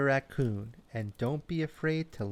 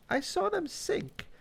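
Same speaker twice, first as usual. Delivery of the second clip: frog voice. Acted.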